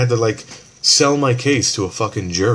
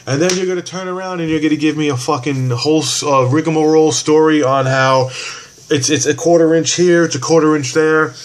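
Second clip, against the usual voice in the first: higher and louder exasperated rant; heated ranting; 8 semitones higher, 6 dB louder than usual. Real, not acted. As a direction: vent the fury over petty rules. Fighting with this lady.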